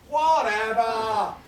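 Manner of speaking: long drawn-out, falling "whatever"; big slow shrug of a word; dismissive refrain. Acted. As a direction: stretch both halves slowly and let it drop. Repeated refrain of performance poem.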